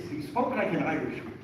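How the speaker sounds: a light Irish lilt on the teacher's words; Irish accent. Shown as a demonstration. Teacher's Irish accent shown to students, lightly done. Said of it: "So he spoke like this".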